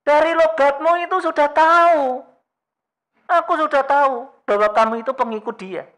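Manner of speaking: high, loud accusation; sharp accusing bystander; accusing woman. Retold or imitated. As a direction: call him out as a liar. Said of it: "si ibu-ibu tadi mengatakan".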